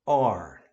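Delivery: "are" as open "ah" into a curled R; English pronunciation model. Shown as a demonstration. Teacher modelling the finished word for learners, clear "are". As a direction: say "are" as one syllable with held R. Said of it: "você vai falar".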